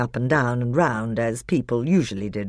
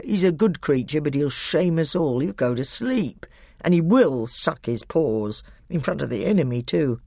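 ordinary delivery, nothing marked

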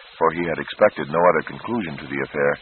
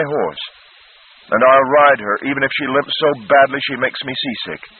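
put-on earnest boy's vow, raised and firm; determined boy; 6 semitones higher, 4 dB louder than usual. Peter pleading for his horse, earnest and raised. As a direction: promise it with all your heart.

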